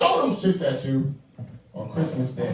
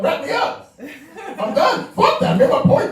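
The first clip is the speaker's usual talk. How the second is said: high, loud exclamations; mock protest; 11 semitones higher, 4 dB louder than usual. Real, not acted. Comic protesting the wrap-up signal, loud and high.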